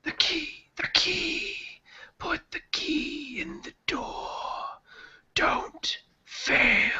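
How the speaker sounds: slow, haunting whisper; drawn-out, eerie hush; voice in the mind. Acted. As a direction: whisper it like a nagging inner voice. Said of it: "just in the back of the mind".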